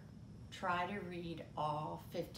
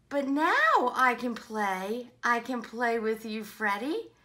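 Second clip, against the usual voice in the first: relishing read-aloud, punched for effect; animated story reading; 7 semitones higher, 18 dB louder than usual. Acted. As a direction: build from calm to a loud comic refusal.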